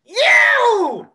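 high, loud celebratory yell; excited shout. Acted. Musician turns to the drummer after the gig, excited. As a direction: holler it at your bandmate. Bandmates praising each other.

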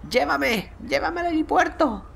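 high, slow pretend demand; mock passenger order. Ordering the game taxi, high-pitched mock passenger voice.

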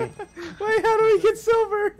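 high, squeaky with laughter; helpless laughing question. Real, not acted. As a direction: ask it while cracking up. Laughing after the scare.

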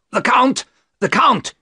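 high, loud alarmed cry; shouted warning. A narrator's reading. Van Helsing warning the men below, loud alarmed shout. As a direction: yell the alarm down the stairs. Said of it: "he shouted down to them".

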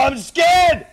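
loud, high scared wail; hammed-up frightened shout; playful panic. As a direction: cry out in mock fright. Frightened in bomb tag.

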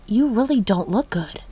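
ordinary delivery

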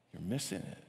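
low, soft, falling; soft low repeat that falls at the end; quiet warning. Real, not acted. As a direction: drop it down to a hushed, grave statement.

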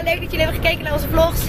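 ordinary talk

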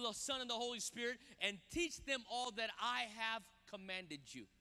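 ordinary delivery

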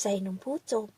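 usual speaking voice

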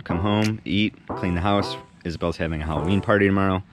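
usual speaking voice

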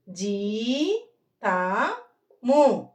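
జీతము stretched out slowly; drawn-out జీతము, syllable by syllable; slow reading demo. Shown as a demonstration. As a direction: read జీతము slowly to the class. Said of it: "teacher models slow reading".

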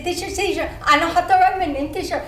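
loud, eager teacher-teacher calling; eager kid. Retold or imitated. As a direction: bounce and call out to the teacher. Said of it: "And I say".

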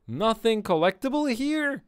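loud, high-pitched disbelief; incredulous outburst. Real, not acted. Player finding no items in the room, incredulous outburst. Louder repeat after finding nothing.

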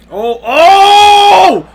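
high, loud oh oh; excited shout. Real, not acted. Seeing the takedown, shouting in real excitement. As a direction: yell out at the takedown.

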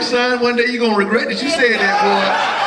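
high, half-shouted through laughter; laughing shout. Real, not acted. Dad warns son, laughing.

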